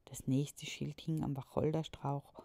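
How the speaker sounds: ordinary delivery, nothing marked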